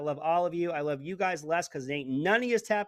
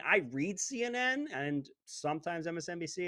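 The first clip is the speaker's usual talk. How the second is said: ordinary delivery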